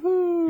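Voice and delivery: squeaky bat whoo; high bat squeak. Pretend. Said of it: "That's my bat impression".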